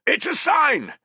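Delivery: high, loud excited cry; overjoyed at the omen. Acted. Soldier crying out at the omen, excited. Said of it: "cried Piso".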